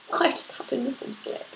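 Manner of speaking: hushed, giggly confession; real giggle, voice dropping away almost to breath; embarrassed laughing aside. Real, not acted. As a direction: laugh it out under your breath. Laughing at own wardrobe mishap.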